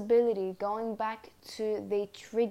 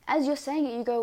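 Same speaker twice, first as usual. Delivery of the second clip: ordinary talk, nothing marked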